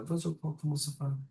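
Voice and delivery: high, soft French warm-up; French accent practice. Pretend. Game master finding the mother's voice, soft French accent. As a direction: test out a French accent quietly. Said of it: "my French accent isn't coming to me".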